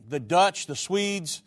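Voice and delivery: normal talking voice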